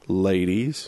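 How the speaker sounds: low, sly "Ladies" with a rise; sleazy wink of a word; suggestive ladies. Shown as a demonstration. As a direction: make it sound cheeky. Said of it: "make any sentence sound wrong".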